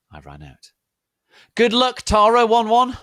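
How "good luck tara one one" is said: high, loud squealed farewell; crowd crying out. Acted. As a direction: cry it out shrill as a whole crowd. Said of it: "everyone cried".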